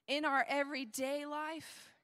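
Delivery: usual speaking voice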